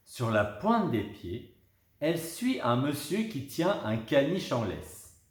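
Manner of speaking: usual speaking voice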